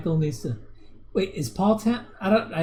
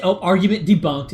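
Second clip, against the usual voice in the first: mocking; 4 semitones higher, 7 dB louder than usual. Mocking a chat argument.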